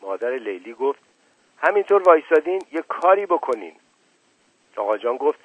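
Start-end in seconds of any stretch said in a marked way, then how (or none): 1.6-3.7 higher, fast, urgent plea to the men. Acted. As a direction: push them to act, quickly and high. Mother urges men to act. (التماس فوری و تند)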